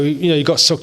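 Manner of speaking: usual speaking voice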